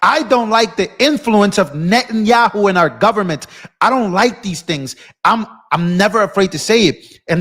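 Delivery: high, heated declaration; worked-up, emphatic venting; defiant rant. Real, not acted. Defiant rant about lost subscribers.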